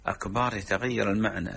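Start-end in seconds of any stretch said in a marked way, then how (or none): 0.1-0.5 أكبر said as "akbaar"; long aa after the b in أكبر; stretched ba. Shown as a demonstration. Sheikh explaining that the word's meaning changes, stretched ba. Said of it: "مد الباء في أكبر".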